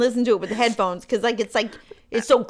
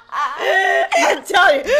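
laughing; 9 semitones higher, much slower than usual. Real, not acted. Laughing fit while talking.

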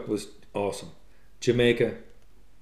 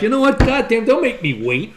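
mock angry; 9 semitones higher than usual. Imitating Doug's rant at the diner, mock angry. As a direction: play up an impatient customer's indignation. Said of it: "Oh, he's so angry".

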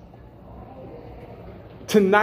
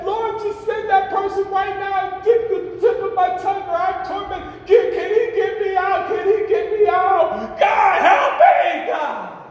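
damned soul crying out; 13 semitones higher, slower than usual. Acted. Rich man in hell begging God to get him out, high wailing shout. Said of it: "Now I'm saying".

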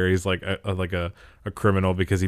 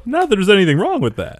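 Seinfeld quote; 12 semitones higher, 7 dB louder than usual. Retold or imitated.

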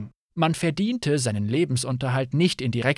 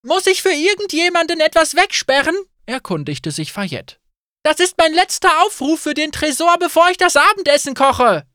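much higher, louder announcing call; 14 semitones higher, 7 dB louder than usual. Acted. Innkeeper announcing last call to all guests, loud.

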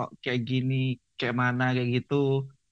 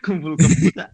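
laughing; 4 semitones higher, 10 dB louder than usual. Real, not acted. Laughs at the kebo joke.

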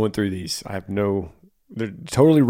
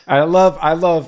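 hammed-up cheery promo voice, higher and louder; mock upbeat intro; 6 semitones higher, 6 dB louder than usual. Podcast host hams up a cheerful TikTok intro, bright and loud.

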